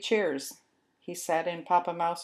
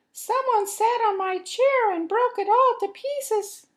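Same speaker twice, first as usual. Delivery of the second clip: very high, louder squeak of alarm; squeaky baby mouse; 8 dB louder than usual. Acted. Baby Mouse finds his broken chair, very high squeak.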